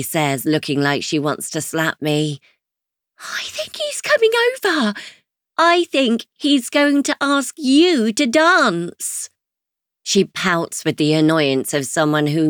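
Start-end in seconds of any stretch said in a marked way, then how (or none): 3.2-9.3 high, breathless, amazed; alarmed he's heading over; excited disbelief. Acted. Chloe alarmed at approaching man.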